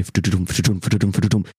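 quick rhythmic hoofbeat noises; imitated galloping. Game master sounds out the creature's approach, imitated galloping. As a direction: drum out galloping with the mouth.

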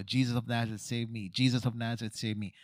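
ordinary talk, nothing marked

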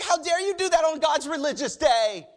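indignant. Retold or imitated. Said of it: "They were like".

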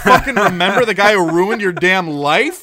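loud, high, disbelieving rant; exasperated outburst. Real, not acted. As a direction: blow up in disbelief at the plot. Outraged at the game's plot.

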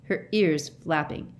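usual speaking voice

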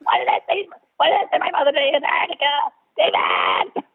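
high, rushed mock wailing; hammed-up frantic whine, crying out the name at the end; character actor impression. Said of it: "that's all he's doing the whole movie".

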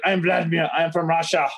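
put-on thick Russian accent. Russian dude greets him at the bar, Russian accent. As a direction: introduce yourself as a Russian character. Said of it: "this, like, fucking Russian dude".

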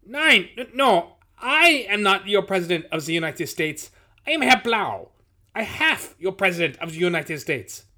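put-on high, loud, flustered German accent; funny German accent. A narrator's reading. Nazi leader correcting himself at the door, German accent. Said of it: "some guy with a funny accent".

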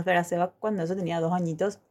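ordinary delivery

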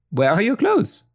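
high, startled ask; surprised question. Acted. Viola startled that Buzzy is in his underwear. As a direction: blurt it out in shock.

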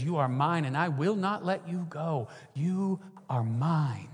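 soft, fading and slowing to a hush; tender divine voice. Retold or imitated. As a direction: speak it gently like a father holding a child. God's tender claim, softening.

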